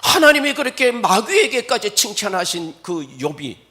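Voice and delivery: loud rising sermon emphasis; full-force preaching. Real, not acted. Preacher stressing Job's righteousness, loud. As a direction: drive it home to the congregation.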